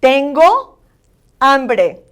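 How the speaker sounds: loud "Tengo" lifting up, pause, "hambre" dropping; emphatic repeat-after-me "Tengo hambre"; accentuated Spanish vowels. Shown as a demonstration. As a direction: model "Tengo hambre" word by word, vowels full. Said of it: "vowels are very important that you accentuate".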